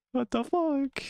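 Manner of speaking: high, squeaky outraged little voice; a tiny drink squeals in protest. Acted. The sugary drink squeals at the viewer, high and outraged.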